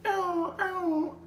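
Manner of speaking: high-pitched siren wail, held level; badly faked police siren whoop; failed siren imitation. Storyteller mimicking the cop car pulling them over, failed siren imitation. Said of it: "I can't even do that voice".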